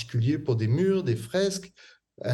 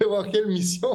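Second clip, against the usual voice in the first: laughing; 10 semitones higher than usual. Real, not acted. Laughs at the accent challenge.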